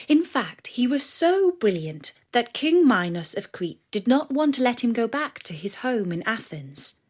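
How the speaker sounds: ordinary delivery, nothing marked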